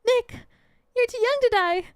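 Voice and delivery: high-pitched desperate calling, acted; alarmed cry. Maya calls to Nick as tiger attacks, alarmed cry. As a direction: cry out for a friend in peril. Maya cries out, friend in danger.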